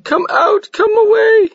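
high, loud frantic cry; crying plea. A narrator's reading. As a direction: pull him away crying.

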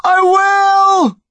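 high, desperate yell. A narrator's reading. Connor promising his terrified mother, desperate yell. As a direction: shout it with everything you have. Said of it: "Connor yelled".

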